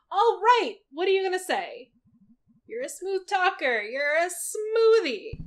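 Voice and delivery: self pep talk. Acted. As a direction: psych yourself up before meeting people. Self pep talk before party.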